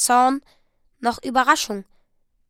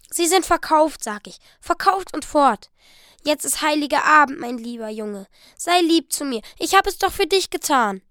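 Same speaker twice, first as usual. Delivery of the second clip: pleading; 4 semitones higher, 6 dB louder than usual. Acted. Della pleads with Jim.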